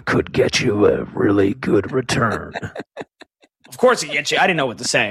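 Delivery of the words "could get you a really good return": deep, dramatic trailer narrator; movie trailer voice. Acted. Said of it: "I just wanted to do the movie guy voice".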